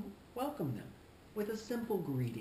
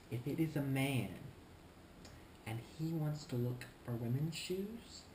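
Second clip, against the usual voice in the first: ordinary talk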